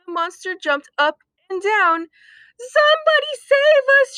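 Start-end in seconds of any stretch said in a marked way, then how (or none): 2.6-4.2 high, loud shriek for help; panicked dogs shrieking. Acted. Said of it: "shrieked the dogs".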